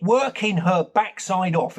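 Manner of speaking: normal talking voice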